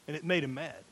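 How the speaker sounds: ordinary delivery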